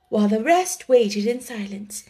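ordinary delivery, nothing marked

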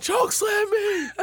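very high, slow mock scream; hammed-up high-pitched yelling; John Cena yelling. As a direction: shriek it as a drawn-out plea, close to laughing. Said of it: "loudly yelling at Undertaker".

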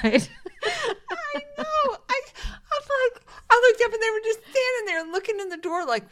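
high, loud, laughing through the words; barely getting the story out for laughing; helpless laughter. Real, not acted. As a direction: squeal it out while cracking up. Cracking up at own story.

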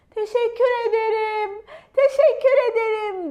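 high, loud grateful call; high-pitched storybook shout; shouting thanks. Acted. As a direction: call out from far below. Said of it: "diye bağırdı".

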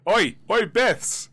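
loud hail, easing off toward the end; calling out. Acted. Player summons the Beths to the holodeck, calling out. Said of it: "I'm gonna call out to the Beths".

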